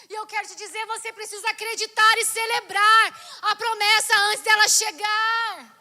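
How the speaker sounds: high, loud, stretched exhortation; fervent preacher's climax; full-force preaching. Real, not acted. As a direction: shout it out to the congregation.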